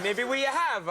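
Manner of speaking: laughing, hammed-up Italian accent; mock Italian accent. Host mimicking tourist English, mock Italian accent. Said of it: "You just add A's at the end".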